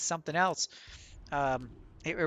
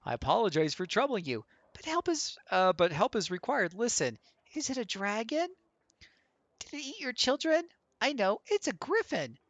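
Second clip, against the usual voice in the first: high-pitched, anxious-to-help questioner; lilting high voice, politely inquisitive; polite game hero; 6 semitones higher than usual. Acted. Hero offering help to a troubled homeowner, high polite voice.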